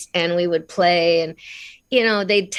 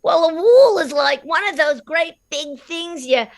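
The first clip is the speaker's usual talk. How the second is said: put-on higher, laughing child's patient explanation; explaining as a child; 9 semitones higher than usual. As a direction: explain it like the eight-year-old, slowing into a laugh. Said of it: "And I said".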